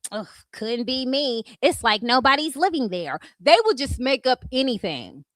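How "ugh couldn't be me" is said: normal talking voice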